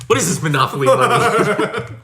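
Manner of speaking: high, loud, laughing mock bewilderment; mock confused customer. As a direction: play the baffled guy, laughing through it. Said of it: "And he's like".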